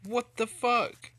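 slow, loud drawn-out yell; angry shout. Real, not acted. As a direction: stretch out the furious shout. Glitch angers gamer.

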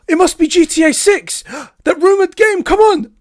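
re-enacted fan frenzy, very high and loud; wildly excited. Fan reliving hopes of GTA six at the stream, wildly excited. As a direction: gasp and cry out, pitch shooting way up. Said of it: "I got really excited".

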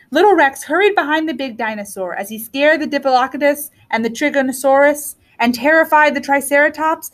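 ordinary delivery

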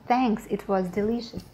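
normal talking voice

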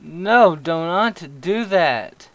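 loud, slow, high pleading; frightened plea. Real, not acted. Player begging the ghost, frightened plea. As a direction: beg it to stop, scared.

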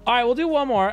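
high and loud announce; hyped host. Acted. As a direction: pump up the chat.

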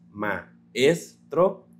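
ma-es-tro in three separate beats; ma-es-tro syllable by syllable; syllables split. Shown as a demonstration. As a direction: break ma-es-tro into ma, es, tro. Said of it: "Maestro lo separaríamos así".

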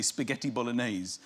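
usual speaking voice

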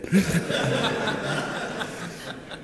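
high, unvoiced laugh fading away; breathless laugh. Real, not acted. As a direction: let the laugh slip out and fade. Laughing at own mom story.

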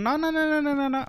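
high-pitched run of no-no-no; rapid refusal. Real, not acted. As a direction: refuse in one high, sustained string. Refuses a costly mistake in game.

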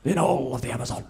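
David Attenborough impression. Comic imitating the nature host praising a waterfall, slow and awed. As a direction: marvel at the waterfall like a hushed presenter. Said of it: "He'll be like".

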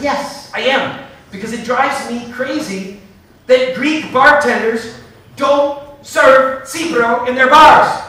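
loud, slow, fired-up insistence; passionate outburst. Retold or imitated. Telling journalists why Greek bars should serve Cipro, fired up and loud. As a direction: hammer it home, every word driven.

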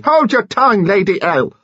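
loud, high-pitched desperate order; frantic imploring. A narrator's reading. Mister Finn silencing Lady Lacklander, frantic imploring. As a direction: beg frantically for her silence. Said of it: "implored her frantically".

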